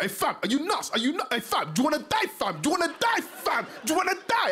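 high, repetitive London street threats; hammed-up mad South London youth; angry rude boy. Rude boy mad over a trodden foot, high threatening voice. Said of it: "some rude boy's".

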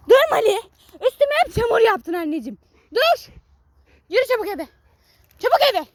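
high, loud scolding of a muddy dog; angry scolding shout. Real, not acted. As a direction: tell the dog off and march it home.

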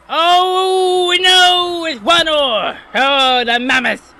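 high, loud dismayed cry; dismayed shout. Real, not acted. Gamer crying out as opponents equalize. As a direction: shout it as the goal goes in.

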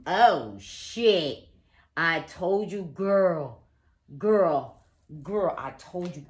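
loud, vindicated exclamation; excited outburst. Real, not acted. Excited outburst, reading seems confirmed.

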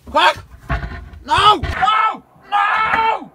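loud high panicked yells; panicked shouting. Real, not acted. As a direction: yell in real panic. Panic in the attic.

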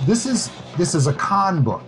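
normal talking voice

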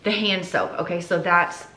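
ordinary talk, nothing marked

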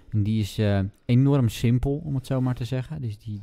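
ordinary talk, nothing marked

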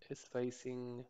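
usual speaking voice

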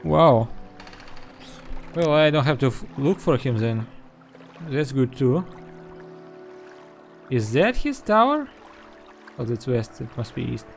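ordinary talk, nothing marked

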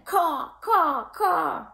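high cawing crow call; crow caws. Acted. Crows jeering at Edward, high caws. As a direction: caw like a mocking crow.

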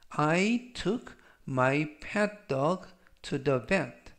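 very slow, clear English sentence; slow reading. Shown as a demonstration. Teacher reads for students to repeat, very slow. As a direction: spell it out slowly for learners to repeat. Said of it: "제가 역시 천천히 세 번씩 읽어드리고요".

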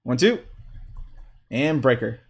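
usual speaking voice

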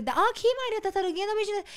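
put-on high-pitched baby-talk request; coaxing a toddler. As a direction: coax a small child for a kiss. (vozinha aguda de falar com criança)

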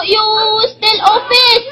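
ordinary talk

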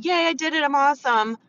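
high-pitched, self-congratulating little cheer; mock cheer. Seller who just checked a box, mock self-congratulation. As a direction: pitch it up in hammed-up triumph.

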